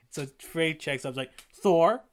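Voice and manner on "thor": sharp, loud call of his name. Retold or imitated. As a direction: call him out like a stern mom. Mother calling her son.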